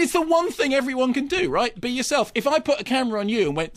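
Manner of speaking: ordinary talk, nothing marked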